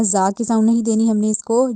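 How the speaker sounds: ordinary talk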